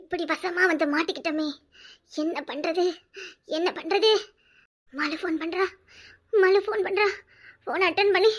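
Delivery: soft, low, hopeless murmur; quiet lament under her breath; hushed despair. Acted. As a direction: mutter to herself, defeated. Trapped, muttering in despair.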